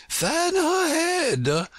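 high, loud, drawn-out order; anxious interruption. A narrator's reading. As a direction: cut in with a worried queen's high command. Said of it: "anxiously interrupted".

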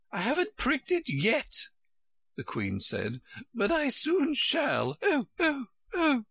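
high, fretful queen voice. A narrator's reading.

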